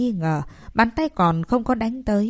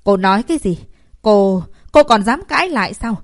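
enraged outburst; 6 dB louder than usual. Acted. Enraged teacher at defiant pupil.

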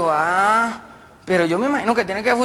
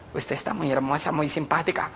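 low flirty driver; 4 semitones lower, 7 dB quieter than usual. Acted.

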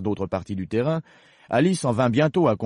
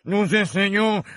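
high, loud servile voice; very humble gardener; 12 semitones higher, 6 dB louder, slower than usual. Acted. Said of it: "commença deux d'une voix très humble".

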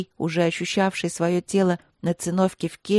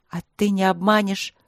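ordinary delivery

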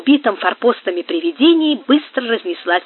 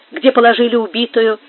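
usual speaking voice

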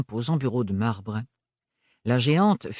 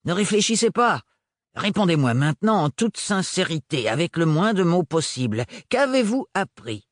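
curt, higher and louder demand; abrupt; 5 semitones higher, 3 dB louder than usual. Acted. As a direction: cut her off sharply. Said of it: "l'interrompit d'un ton abrupt".

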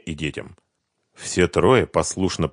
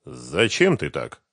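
ordinary delivery

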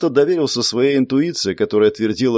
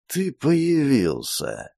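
serpent spirit voice; 3 semitones higher, 5 dB quieter, much slower than usual. Acted.

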